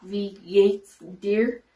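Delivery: slow, spaced-out German phrase; slow teaching demo. Shown as a demonstration. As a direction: model it slowly for learners, word by word. Teacher models German phrase for learners.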